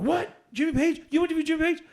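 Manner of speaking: mock amazed fan. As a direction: play him leaning in, starstruck.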